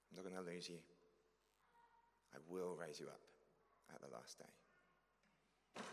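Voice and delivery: put-on a loving promise whispered to a child; tender voice of Jesus. Jesus voiced speaking to the listener.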